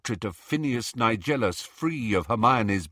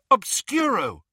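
high, loud spell cry; cried out; 12 semitones higher, 5 dB louder than usual. A narrator's reading. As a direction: cast it with a sudden high cry. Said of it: "Hermione cried".

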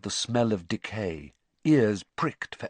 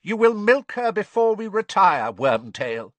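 high, loud, cold villain voice; cold command from a dark lord; icy cold; 10 semitones higher, 8 dB louder than usual. A narrator's reading. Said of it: "said the second voice".

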